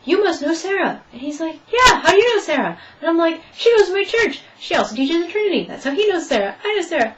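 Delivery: high, excited back-and-forth; excited retelling. Retold or imitated. Retelling the interview, excited high chatter about Sarah. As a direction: rattle off the connections with glee. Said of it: "And then I was like".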